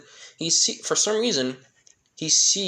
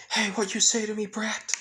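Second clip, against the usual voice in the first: high-pitched retold outburst; mock scream; 9 semitones higher, faster than usual. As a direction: fire it back in a much higher voice, not truly screaming. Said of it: "Revali just screaming".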